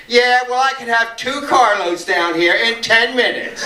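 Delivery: high, forceful one-upping; imitated defiant comeback. Marcia answering the dealer's threat, imitated defiant comeback. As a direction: top the bully's boast with relish.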